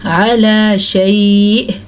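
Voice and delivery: slow chanted verse; slow recitation. Shown as a demonstration. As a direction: recite slowly. (धीमी तिलावत)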